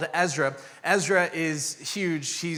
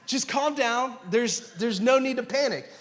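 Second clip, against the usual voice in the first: lightly mocked reassurance, pitched up; imitating male bravado; 7 semitones higher than usual. Husband calming his pregnant wife, mock bravado. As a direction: play the man who claims control. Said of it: "every male is like".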